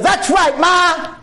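high, loud shout at mum; wild yell. Retold or imitated. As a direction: yell it as he swings. Boy shouts while hitting mother.

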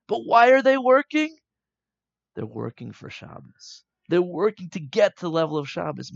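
high, loud eureka voice; excited realization. Acted. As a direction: burst out with sudden understanding. Rabbi Shimon's sudden realization voiced.

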